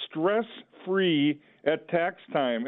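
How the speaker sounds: each word of Stress Free at Tax Time spaced out slowly, Free stressed; slow, deliberate title. Real, not acted. Announcing the show's title.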